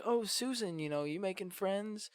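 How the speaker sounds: high, soft sing-over to Susan; softly voiced, lifted friendly question; retold friendly greeting.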